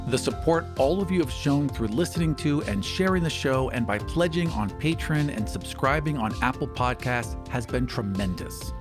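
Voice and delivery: ordinary talk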